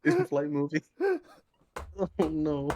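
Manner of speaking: high, laughing question; helpless giggly disbelief; cracking up. Real, not acted. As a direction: repeat it through real laughter. Cracking up at running theater joke.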